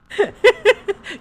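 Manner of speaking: loud high cackling laugh. Real, not acted. Big laugh after mock outrage.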